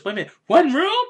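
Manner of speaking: high, loud, quick burst with rising end; startled question. Retold or imitated. Kazuki learning only one room was booked, startled. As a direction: blurt it out in disbelief. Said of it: "He goes".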